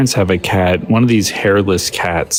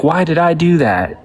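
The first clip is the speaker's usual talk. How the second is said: high-pitched catchphrase voice; Steve Urkel; 7 semitones higher than usual. Acted. Guest quoting an old sitcom joke, high character voice. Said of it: "Steve Urkel joke".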